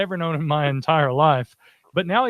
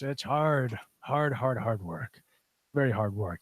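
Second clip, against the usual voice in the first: lower, softer pirate grumble; lightly voiced weary pirate; 4 semitones lower, 6 dB quieter, slower than usual. Pirate telling the fella about life, low and worn. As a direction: sigh out the hard work, old salt. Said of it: "he says".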